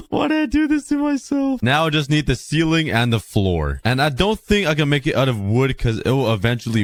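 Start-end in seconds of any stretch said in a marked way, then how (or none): 0.1-1.6 high, whining self-pity; whiny. Real, not acted. Gamer moaning about the rebuild in a high whine. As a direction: wail it at yourself, half joking. Whining after a tedious build.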